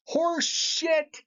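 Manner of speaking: a sudden disgusted blast; furious outburst. Real, not acted. Ranting at lenders, a furious high scoff. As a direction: spit it out, high and scornful. Angry rant about student loans.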